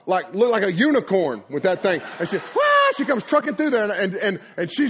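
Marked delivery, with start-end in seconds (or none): 2.4-2.9 loud, very high "wah"; little girl's squeal. Retold or imitated. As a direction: imitate a child charging through squealing. Imitates Ella tearing through.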